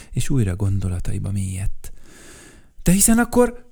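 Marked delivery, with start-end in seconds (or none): 2.8-3.6 much higher, louder sudden cry. Acted. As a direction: exclaim with a sudden idea. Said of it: "kiáltott Vacska Mati". (hirtelen felkiáltás, magasan)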